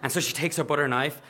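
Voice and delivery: plain reading voice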